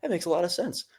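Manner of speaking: softly acted, receptive amazement; retold open-minded wonder. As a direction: quote her quietly, as if impressed. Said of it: "she was, like, open, and she was like".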